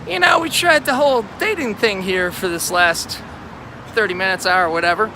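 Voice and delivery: high-pitched, awkward offer to a date; acted casual pitch. Guy pitching matchmaking to a failed date, high-voiced. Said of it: "I could just say".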